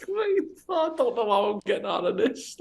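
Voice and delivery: high, squeaky voice breaking into laughter; helpless, high-pitched giggly retelling; laughing helplessly. Retold or imitated. Laughing, retelling his hopeless plight.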